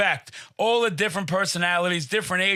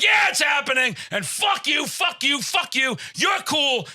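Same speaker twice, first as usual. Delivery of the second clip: raised, high-pitched defiant yell; defiant shouting; 5 semitones higher than usual. Retold or imitated. Twerking contestant answers the poor host, shouting defiantly. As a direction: shout it round at each person in turn.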